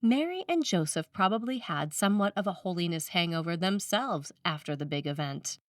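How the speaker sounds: ordinary talk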